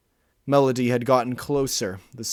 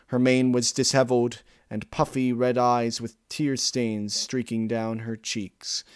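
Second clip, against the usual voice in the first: usual speaking voice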